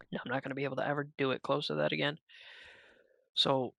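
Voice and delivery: ordinary talk, nothing marked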